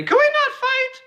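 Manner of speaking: high, loud comic plea; outburst punchline. Retold or imitated. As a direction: cry it out way up high. His retold punchline to polite disagreement.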